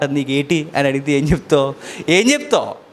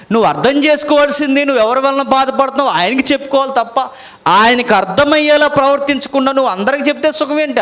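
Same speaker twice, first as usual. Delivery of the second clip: fervent urging; 8 semitones higher than usual. Real, not acted. Preacher urging couples strongly.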